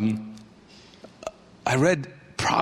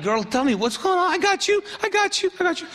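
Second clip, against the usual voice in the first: mock-comforting pastor, pitched way up; pastor mode, hammed up. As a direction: play the overeager pastor who has it all under control. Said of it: "so I go into pastor mode".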